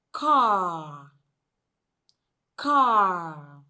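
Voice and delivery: "car" with a stretched "ah", no r; long vowel. Shown as a demonstration. Teacher reads example word to class, long vowel. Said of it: "this particular sound, sound occurs in words like".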